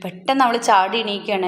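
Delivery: usual speaking voice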